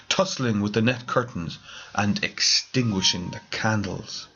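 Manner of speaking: plain reading voice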